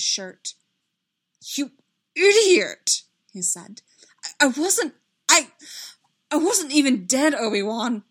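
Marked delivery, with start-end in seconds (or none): 1.4-3.0 loud, high, furious outburst; explosive, distraught reproach; anguished yell. A narrator's reading. Lunges at his friend. 4.2-8.0 loud, high, stammering protest; anguished, halting shout; distraught outburst. A narrator's reading. Lunging, distraught at friend.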